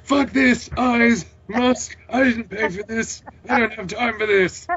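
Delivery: high, loud ranting shout of frustration; furious outburst. Acted. Reading the character's outburst aloud, a high furious yell. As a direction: blow up at the whole situation, yelling. Voicing an angry character in story.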